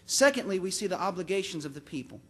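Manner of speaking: ordinary talk, nothing marked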